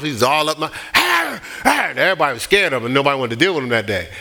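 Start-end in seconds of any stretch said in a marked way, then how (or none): 0.9-1.9 high-pitched raving growl, imitated; mock rabid roar; foaming-at-the-mouth snarl. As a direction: act out a man raging in your face. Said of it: "He's foaming at the mouth".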